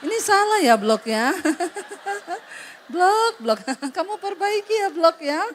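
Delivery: chuckling while calling him stupid; laughing insult. Retold or imitated. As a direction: scold through a laugh, sweetly. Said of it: "Dia tersenyum".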